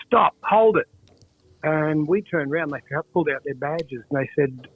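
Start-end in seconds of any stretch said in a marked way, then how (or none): 0.1-0.9 retold cop yell. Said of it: "ran over and said".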